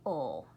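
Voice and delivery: "ul." low, short ul; TLE ending sound. Shown as a demonstration. Teacher modeling the ending sound, low. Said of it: "it's going to say".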